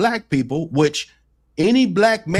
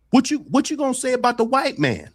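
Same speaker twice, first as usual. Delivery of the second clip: higher-pitched mock confrontation; retold challenge; 11 semitones higher, faster than usual. Retelling Corey's challenge to a guest on the show, pointedly challenging. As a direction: imitate a challenger, pitched well up.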